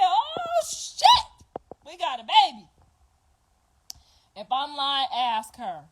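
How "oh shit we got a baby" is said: much higher, louder joyful yell; excited shout. Retold or imitated. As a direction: cheer it out as the baby arrives. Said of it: "I said".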